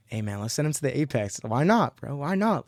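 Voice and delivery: ordinary delivery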